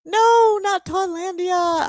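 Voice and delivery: high, loud playful protest; laughing dismay at the wrong name; surprised no. Real, not acted. The draw lands on the wrong name.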